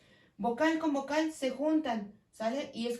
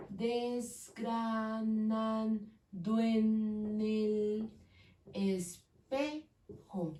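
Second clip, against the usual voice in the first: very slow, each syllable pulled apart; very slow syllable count; much slower than usual. Shown as a demonstration. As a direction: count out "Desgranando en el espejo" one beat at a time. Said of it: "Ocho sílabas".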